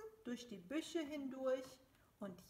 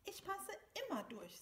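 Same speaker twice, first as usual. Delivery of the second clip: lightly proud little mouse, higher and quick; a touch of pride, pitched up and brisk; 7 semitones higher than usual. A narrator's reading. Little mouse boasts to big mouse of fitting through, mildly proud. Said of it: "sagte kleine Maus stolz".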